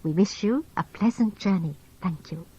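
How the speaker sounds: normal talking voice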